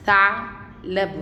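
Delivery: "ثعلب" slowly, split after ع; stop on the ayn. Shown as a demonstration. Teacher quizzes pupils on the stop, pausing at ع inside "ثعلب". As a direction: halt on the vowelless ayn in "ثعلب" before the last syllables. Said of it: "انا وقفت هنا عند حرف".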